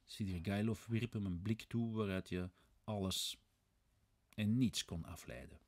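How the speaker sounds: plain reading voice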